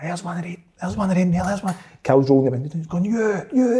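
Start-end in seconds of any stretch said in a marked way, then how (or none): 0.0-1.7 higher, softer mock-urgent pointing out; acting out a startled friend spotting someone; imitated urgent alert. Friend spots one of them from police car, mock urgent. 3.0-3.8 high-pitched mock call to someone; retold accusing call, pitched up; imitated calling out. Friend calls at the boy from car window, imitated call-out.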